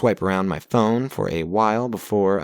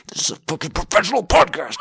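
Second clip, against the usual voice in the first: fast, high exasperated rant; frustrated outburst; 10 semitones higher, 4 dB louder, faster than usual. Real, not acted. Dogs barking during recording.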